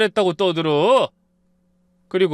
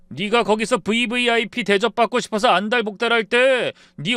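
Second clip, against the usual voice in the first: mild mock yell; 6 semitones higher, 3 dB louder than usual. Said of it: "버럭 소리를 질렀습니다".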